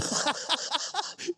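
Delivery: sudden high burst of laughter. Real, not acted. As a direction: laugh at the punchline. Laughs at own story. (tawa tinggi yang meledak)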